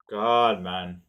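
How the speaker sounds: a real loud exasperated cry; frustrated groan of God. Real, not acted. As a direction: let out real frustration at himself. Frustrated exclamation.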